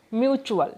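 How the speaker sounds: Mutual with the weight on mu; stress on first syllable. Shown as a demonstration. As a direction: lean on the opening mu of mutual. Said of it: "mu जो syllable है उसके ऊपर".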